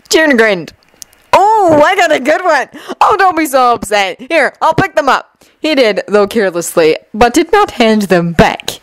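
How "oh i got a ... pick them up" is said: higher, playful placating; gloat, then brush off the upset; cheeky troublemaker, unbothered. Acted. Jun grins at furious Shinobu, cheeky and unbothered.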